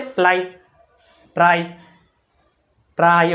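પ્લાય, ટ્રાય with an "eye" vowel, set apart by pauses; wrong "ai" reading. Shown as a demonstration. Teacher asking if pupils ever read it so, wrong "ai" vowel. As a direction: misread play and tray as પ્લાય, ટ્રાય, word by word. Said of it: "આપણે કોઈ દી વાંચ્યું".

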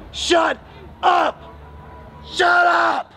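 a heckler drowning out the preacher; angry shout. Real, not acted.

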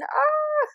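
short high startled cry; frightened high yelp. Real, not acted. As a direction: yelp in panic. Panicking at door.

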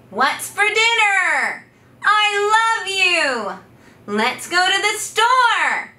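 high, loud and slow, as if across a fence; high calling voice. Shown as a demonstration. Coach practicing daily phrases in a high calling voice. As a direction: sing each phrase out over the fence. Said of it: "high-pitched voice, like I'm calling over a fence".

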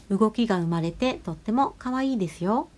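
ordinary delivery

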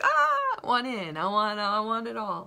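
high and loud, bursting with wanting it all; a puppy that can't contain herself, loud and high; greedy excited dog. Retold or imitated. Dog Ada telling the communicator she wants it all, excited and loud.

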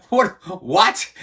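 retold high, loud double what of disbelief; shocked what. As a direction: blurt it out, stunned. Stunned reaction to the street scene.

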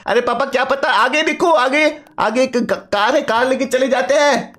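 son's high, chatty voice; eager son. Acted. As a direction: play the excited kid calling out to papa.

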